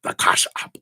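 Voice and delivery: hard, voiceless mock German accent; hammed-up German accent, hard consonants. Reader showing off her impression, hammed-up German accent. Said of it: "That's my German impression".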